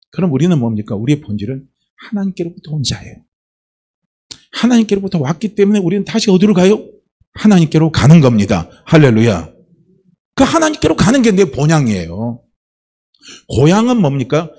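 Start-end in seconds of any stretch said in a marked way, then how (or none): none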